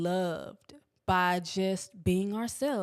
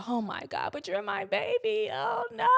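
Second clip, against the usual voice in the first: much higher, mock-doting mother; mom's clingy whine; 13 semitones higher than usual. Mom hearing about the California trip, mock whine. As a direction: whimper it like a fussing mom. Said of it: "she's like".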